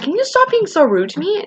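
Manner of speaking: high-pitched retold indignation; pissed off. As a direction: replay an irritated protest, voice shooting up. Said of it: "I got so pissed".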